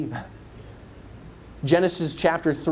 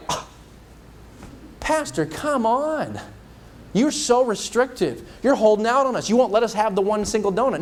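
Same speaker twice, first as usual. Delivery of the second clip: whiny complaint; 6 semitones higher than usual. Pretend. Church member whining to the pastor, mock whine. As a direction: pout at the pastor over one donut. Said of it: "Would anybody here be like".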